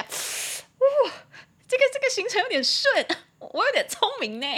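very high, loud playful squeal; gleeful self-congratulation. Pretend. Teacher admiring their own date plan, gleeful.

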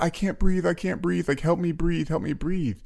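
high, whiny mock panic of a five-year-old; mock panicked child. Daughter upset, imitated by her dad: mock panic. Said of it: "she's like".

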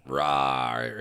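low playful dinosaur rawr; deep distorted monster roar. Pretend.

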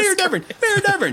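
high, loud call for the mayor; the boy still shouting for the mayor; yelling. Acted. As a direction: shout the name again and again.

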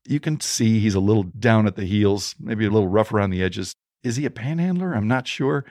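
ordinary talk, nothing marked

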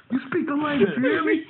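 high, laughing parody of an angry patriot; mock nativist rant. Mocking the veteran's rant, high laughing parody. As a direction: lampoon a bigot while cracking up.

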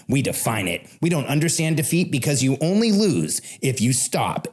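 announcer read. Acted. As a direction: declaim it like a rallying manifesto. Recorded podcast intro manifesto.

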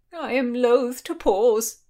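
high, slow, loud objection; drawn-out, emphatic refusal; insisting. A narrator's reading. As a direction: insist with lofty reluctance. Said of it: "insisted the Marquis".

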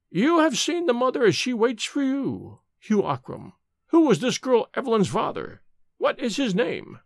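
high, intoned interrogation; relentless high-pitched demand; tormenting old nurse. A narrator's reading. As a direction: press the dying man with high, insistent questions. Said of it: "Nurse MacDonald tortured him".

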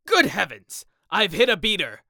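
loud, high-pitched alarm; startled, booming outburst; shocked exclamation. Acted. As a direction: burst out in dismay. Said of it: "exclaimed Sir Geoffrey".

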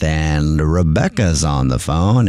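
slow, low announcer call of the caller; drawn-out play-by-play announcer; baseball announcer voice. Acted. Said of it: "the reason I'm speaking in the baseball announcer voice".

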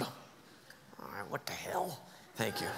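breathy whispered give-in; resigned whisper. Real, not acted. Giving in to his partner, whispered. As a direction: concede under the breath.

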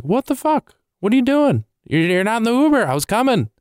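high-pitched indignant imitation; mock outraged girlfriend. Girlfriend finding him out of the Uber, mock outrage. Girlfriend's angry reaction, acted out.

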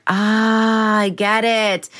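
drawn-out "ah" of mild relief, then getting it; dawning realization. Acted. Podcaster voices a listener's aha moment, dawning realization. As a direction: let a long "ah" open into understanding.

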